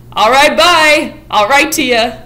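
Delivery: hammed-up careless exit; breezy goodbye. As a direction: shrug it off and stroll away. Monty leaving after rejection.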